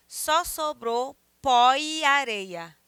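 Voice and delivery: "pó e areia" slow and loud, e gliding into a; vowels joined. Shown as a demonstration. Teacher demonstrating elision, "pó e areia" said slowly as pó-ia-reia. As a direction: read "Só sobrou pó e areia" drawn out, linking the i to the a. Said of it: "eu vou juntar o i".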